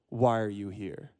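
low, slow, softened question; calm, reflective. Acted. Rabbi repeating the guard's question as a daily reminder, calmly. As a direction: ask it slowly and softly, to sink in.

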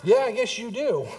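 mock burst of amusement; imitated laughing reply. Wife cracking up at her son, imitated laughing reply. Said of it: "she just burst out laughing, going".

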